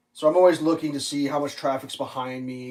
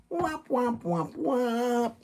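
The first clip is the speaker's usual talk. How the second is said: womp womp; 10 semitones higher, much slower than usual. Pretend. Mock sad trombone sound.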